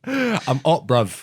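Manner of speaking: mock London slang; lightly voiced London roadman; a hint of a London roadman accent. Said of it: "Top Boy shit".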